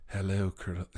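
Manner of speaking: a hint of a creepy, menacing whisper-edged Lecter; Hannibal Lecter impression. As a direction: try out the Lecter greeting. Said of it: "I can do the voice of Doctor Hannibal Lecter".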